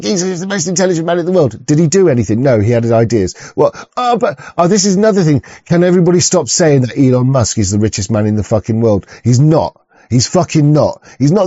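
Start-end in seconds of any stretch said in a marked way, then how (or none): none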